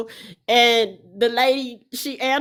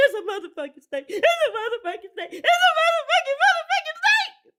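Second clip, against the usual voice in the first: high, fast squealed shriek, climbing higher; retold screaming panic; faster than usual. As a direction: mimic yourself hollering in hysterics, racing and squeaky. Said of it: "So I start screaming".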